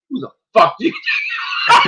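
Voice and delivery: high, laughing disbelief as the man from the bookstore; incredulous laughing quote. Retold or imitated. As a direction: laugh through the retold question. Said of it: "he's like".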